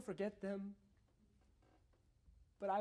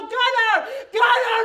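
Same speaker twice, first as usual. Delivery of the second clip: high, loud panicked yell; screaming his name; 12 semitones higher, 18 dB louder than usual. Retold or imitated. Father screaming as son falls into river. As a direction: scream for your falling child.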